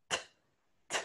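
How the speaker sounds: "t" popped hard as a lone consonant; isolated strong T sounds; strong English T. Shown as a demonstration. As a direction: give a forceful English "t", twice. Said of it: "la T en inglés es fuerte".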